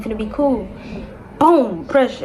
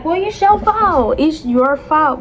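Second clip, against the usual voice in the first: slow, playful mock scolding of the baby; sing-song fake blame; teasing blame; 4 semitones higher, slower than usual. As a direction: tease him it's his fault. Playfully blaming newborn son.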